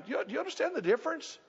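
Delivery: ordinary talk, nothing marked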